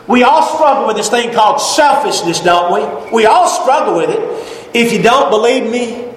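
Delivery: ordinary talk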